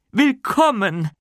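high, eager little man's greeting; excited welcome. Acted. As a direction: welcome them in a rush of excitement. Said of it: "aufgeregt von einem kleinen Mann begrüßt".

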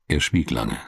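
ordinary delivery

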